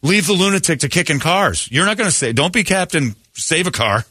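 alarmed, insistent "no, no, no" at the screen; high-pitched exasperated urging; urgent warning. Retold or imitated. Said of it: "I'm like".

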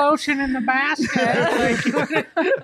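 high, slow mock creepy villain; movie villain impression. Panelist quoting Silence of the Lambs, hammed-up high villain voice. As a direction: draw it out high like a film psycho. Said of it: "Silence of the Lambs?".

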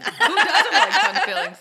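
laughing high and loud. Real, not acted. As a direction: crack up mid-question. Laughing at the joke.

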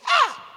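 short, very high, loud cry of ah. Retold or imitated. Preacher showing the congregation how Jesus cried out, loud high cry. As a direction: let out a piercing dying cry. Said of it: "he cried out like this".

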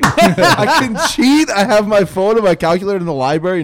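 high, loud, laughing excitement; gleeful realization. Retold or imitated. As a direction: crow it like you found a loophole. Retells delight at unwatched test.

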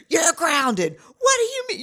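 high, loud, squealed parental outburst; hammed-up indignant yell, pitch shooting up; mock outrage. Mom reacting to her son accepting her apology, mock outraged.

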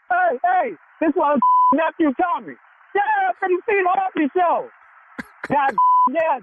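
high, excited call to others nearby; excited announcing. Real, not acted. Pranked worker telling the factory about Nephew Tommy, excited. Tells coworkers who is calling.

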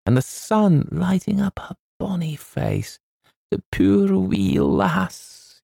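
put-on snuffle through the memory of the girl; tearful Scotsman. Agent weeping to the author over the girl. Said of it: "he muttered".

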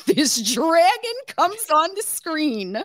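very high, loud squeal of laughter; hysterical laughing. Real, not acted. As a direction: shriek it out while cracking up. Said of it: "now I'm hysterical laughing".

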